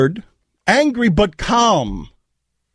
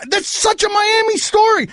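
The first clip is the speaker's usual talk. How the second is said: angry shout; 10 semitones higher than usual. Real, not acted. Host furious about station threat.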